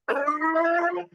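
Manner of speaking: high, playful mock roar; mock tantrum growl. A parent mimics a toddler's tantrum as a high mock roar.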